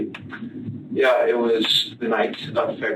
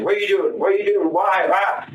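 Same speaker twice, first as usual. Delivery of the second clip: mock panicked pleading, pitched much higher; panicked old man; 8 semitones higher than usual. Confessing suspect mimics his victim's cries, high panicked mimicry. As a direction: imitate a man panicking, high urgent questions. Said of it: "panicking at first in his old man voice".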